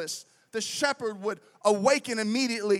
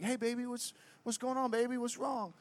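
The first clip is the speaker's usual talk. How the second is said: hushed, gentle late-night voice; soft concern; 9 dB quieter than usual. Retold or imitated. Husband rolling over to his wife at night, softly concerned. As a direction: ask it softly, as if in bed.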